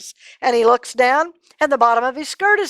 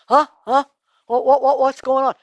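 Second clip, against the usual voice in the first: startled, higher stammer; groggy confusion; 4 semitones higher, 3 dB louder than usual. Acted. Saul waking confused, startled stammer. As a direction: wake up confused. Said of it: "Saul says".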